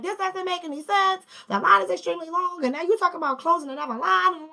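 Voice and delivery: high-pitched complaint, no real volume; mock yelling. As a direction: imitate an angry customer going off. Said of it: "He's yelling, he's screaming".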